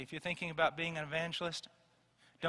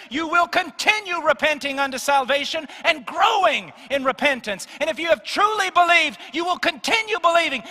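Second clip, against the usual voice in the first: loud, much higher, hammering sermon delivery; full-force preaching; 10 semitones higher, 15 dB louder than usual. Acted. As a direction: preach it hard to the congregation.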